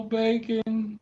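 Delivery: excited call. Real, not acted. Calling the dog excitedly.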